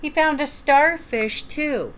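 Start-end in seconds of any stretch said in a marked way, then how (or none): none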